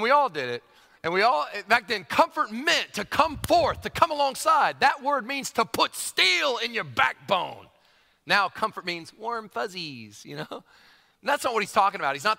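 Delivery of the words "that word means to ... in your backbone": slow, near-shouted declaration; forceful emphasis. Acted. Preacher insisting to the church, forceful and slow. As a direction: drive each word home, slowly, almost shouting. Preacher hammering the point home.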